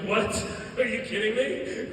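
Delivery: loud disbelief with a laugh; incredulous reaction. Retold or imitated. Speaker reacts to brother's health talk, laughing disbelief. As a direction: scoff loudly in amused disbelief.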